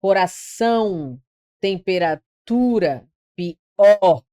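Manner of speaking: coração, temperatura, pior, each split before its strong syllable; word list with each stressed syllable stretched; stressed syllables singled out. Shown as a demonstration. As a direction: slowly lean on ção, tu and or in coração, temperatura, pior. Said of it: "todas as palavras oxítonas".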